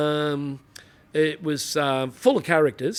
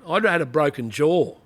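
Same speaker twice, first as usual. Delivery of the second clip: ordinary talk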